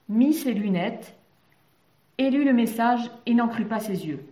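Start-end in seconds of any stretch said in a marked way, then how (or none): none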